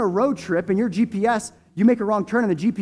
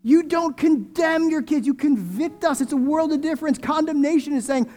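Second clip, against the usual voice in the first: preaching at full force; 8 semitones higher than usual. Real, not acted. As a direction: lift it up and belt it out.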